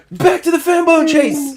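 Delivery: high, loud call back to the action; excited announcement. Real, not acted. GM pulls the players back to the chase, high and loud. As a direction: announce it like the next round of a show.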